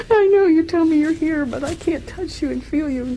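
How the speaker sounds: imitating herself crying and apologizing; retold crying. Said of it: "And then I cry all the time".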